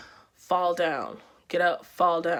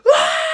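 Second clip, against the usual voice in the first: very high, loud acted yell of fury; retold rage scream; 11 dB louder than usual. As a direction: let out a full snapping-point scream. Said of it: "All I remember is being like".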